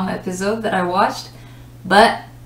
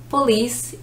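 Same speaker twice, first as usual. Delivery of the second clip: POLICE as puh-LEECE, higher pitched; second-syllable stress; 4 semitones higher than usual. Shown as a demonstration. Teacher recapping where stress goes, second-syllable stress on police. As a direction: put the weight on the end of "police". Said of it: "stress on the second syllable".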